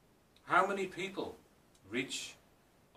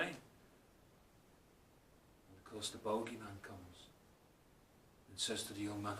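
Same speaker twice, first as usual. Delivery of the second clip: ordinary talk, nothing marked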